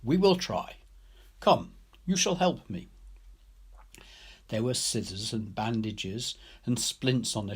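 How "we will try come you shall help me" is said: a lightly voiced kind man, a touch higher; reassuring Ryder. A narrator's reading. Ryder reassuring the girl, lightly voiced and kind. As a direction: gently invite the child to help.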